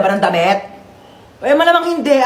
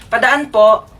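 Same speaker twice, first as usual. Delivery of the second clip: ordinary delivery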